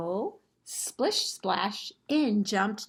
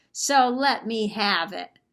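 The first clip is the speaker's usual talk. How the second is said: defiant troll; 3 semitones higher, 7 dB louder than usual. Acted. Troll defying Mama Bear.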